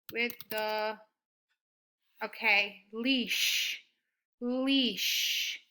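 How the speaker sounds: words drawn out while writing; slow dictation. Shown as a demonstration. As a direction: dictate to yourself slowly. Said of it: "slow dictation while writing".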